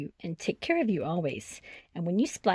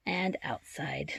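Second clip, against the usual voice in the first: soft slow whisper; whispered to a sleepy child; 8 dB quieter, slower than usual. Acted. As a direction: breathe it out slowly. Whispered line in bedtime book.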